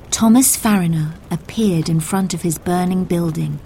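ordinary delivery

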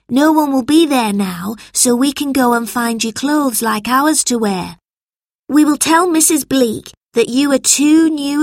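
put-on high, eager boy's voice; helpful schoolboy. A narrator's reading. George hurries the newcomers along, high boyish voice. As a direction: play a boy taking charge, pitched high.

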